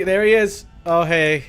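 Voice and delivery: ordinary talk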